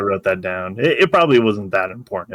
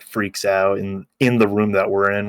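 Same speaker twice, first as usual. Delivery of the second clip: normal talking voice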